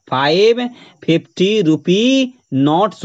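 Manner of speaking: slow, spaced words with रुपी held open on "ee"; plural on नोट्स. Shown as a demonstration. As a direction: give the correct phrase slowly, नोट्स ending in "ts". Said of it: "नोट्स में लगाना है".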